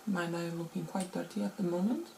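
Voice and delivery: ordinary talk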